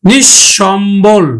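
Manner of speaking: slow, stretched নিঃসম্বল, nish-shom-bol; doubled palatal sh. Shown as a demonstration. Teacher modelling for students, slow nish-shom-bol for নিঃসম্বল. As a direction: draw out every syllable of নিঃসম্বল with doubled sh. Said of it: "আমরা উচ্চারণ করি কিন্তু".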